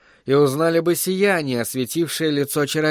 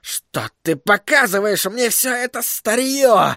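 high-pitched indignant tirade; exasperated outburst; 8 semitones higher than usual. A narrator's reading. Sorcerer scolding crystal for old scenes, exasperated.

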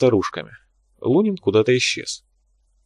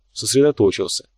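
ordinary delivery

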